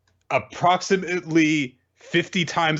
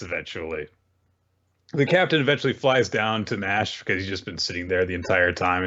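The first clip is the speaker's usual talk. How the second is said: normal talking voice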